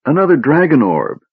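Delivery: high, plaintive wail, acted. A narrator's reading. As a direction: wail it miserably. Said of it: "Tass wailed".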